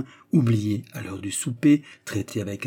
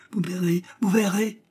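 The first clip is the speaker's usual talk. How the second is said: high-pitched, excited insistence; eager promise; 10 semitones higher than usual. Acted. Suitor about to show the letter, excited. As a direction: promise proof with mounting eagerness.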